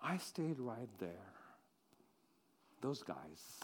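soft, low, dejected voice; barely above a breath, lost in grief; grieving hush. Acted. Mary alone at the tomb, soft and grieving.

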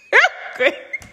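giggling real quick through a laugh. Real, not acted. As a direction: crack up mid-phrase.

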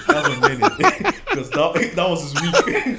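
laughing high and loud; cracking up while blaming. Real, not acted.

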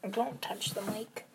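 slow hushed whisper. Real, not acted. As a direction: whisper a warning. Whispering near the mic.

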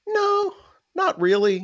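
high playful feigned modesty; coy denial. Retold or imitated. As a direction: play it coy and innocent. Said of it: "I was like".

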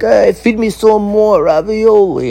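high, slow, hammed-up Italian boss voice; a fat boss bee ordering his servants, hammed-up Italian mobster; Italian mob boss. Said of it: "overweight Italians of the bees".

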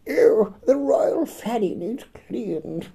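high, slow mock posh; prissy high lady voice.

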